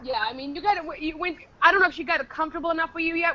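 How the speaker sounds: normal talking voice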